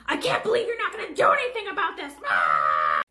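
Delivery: high loud frustrated cry; frustrated yell. Acted. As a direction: storm off with a shout. Customer storms off yelling.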